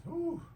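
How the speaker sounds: high whistling whew of relief; dramatic sigh. Real, not acted. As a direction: blow out a big relieved sigh. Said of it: "big sigh of relief".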